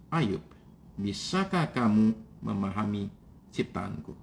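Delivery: a quiet, knowing jab from God; sarcastic God. Retold or imitated. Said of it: "Kira-kira ngomongnya begini". (Tuhan bertanya pelan dan sinis)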